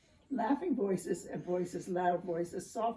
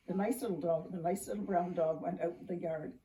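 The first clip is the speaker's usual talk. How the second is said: ordinary delivery, nothing marked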